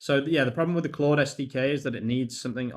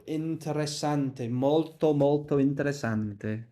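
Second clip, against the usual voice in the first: mock Italian; 4 semitones higher, slower than usual. Mock Italian while reading code.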